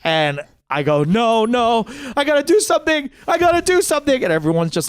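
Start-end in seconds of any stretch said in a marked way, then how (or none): 1.0-4.2 high, urgent mock panic; retold freak-out. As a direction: insist frantically, climbing higher as you repeat. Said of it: "freaking out".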